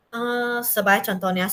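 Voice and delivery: ordinary talk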